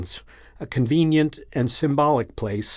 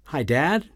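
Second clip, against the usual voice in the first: "Hi, Dad?" with a rising lilt at the end; said as a question. A narrator's reading. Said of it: "saying it as a question".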